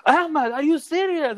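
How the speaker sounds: very high, slow, scandalized mother calling her son's name; shocked mom. Retold or imitated. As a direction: mom can't believe her ears, high and drawn out. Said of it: "And his mom's like".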